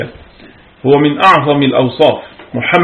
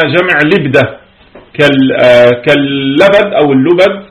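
normal talking voice

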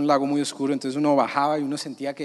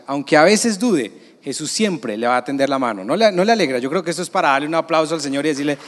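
ordinary talk, nothing marked

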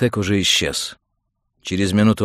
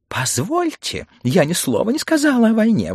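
very high-pitched protest; indignant exclamation; 14 semitones higher, 4 dB louder than usual. Acted. Mrs Phelps denies talking about war, exclaiming. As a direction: object in a high, offended cry. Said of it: "воскликнула миссис Фелпс".

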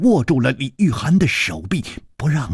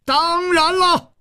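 very high, drawn-out roar; 3 dB louder, slower than usual. A narrator's reading. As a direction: roar it back at the very top of the voice. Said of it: "李玉涵也大吼着". (高声大吼)

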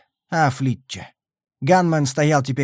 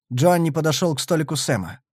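plain reading voice

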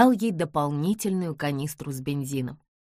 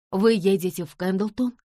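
out of breath; 4 semitones higher, 4 dB louder than usual. Acted. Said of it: "запыхавшись, выпалила".

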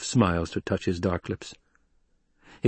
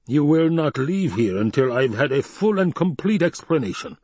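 higher-pitched, insistent order; imperious demand; 7 semitones higher, 3 dB louder than usual. Acted. Baron forbids Hawat to leave, imperious. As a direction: lay down the law as the Baron.

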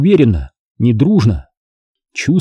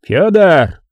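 higher-pitched commanding call; barked call; 6 semitones higher, 3 dB louder than usual. A narrator's reading. Commander summoning his man in battle, barking. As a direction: bark the name as a summons. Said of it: "гаркнул Бобёр".

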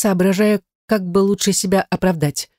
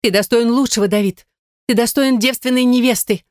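lightly voiced Gaine; 6 semitones higher than usual. A narrator's reading. Gaine pleading high.

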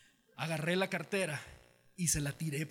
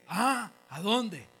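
acted questioning exclamation; 4 semitones higher, 8 dB louder, much slower than usual. Acted phone call, stranded driver.

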